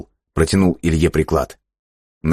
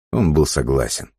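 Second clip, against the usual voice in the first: ordinary delivery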